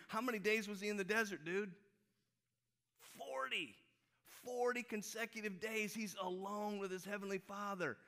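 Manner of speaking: high-pitched, worked-up buddy impression; imitating an excitable preacher friend; Slim's excitable voice. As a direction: urge your pal on in a high, insistent voice. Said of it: "That's how he talks".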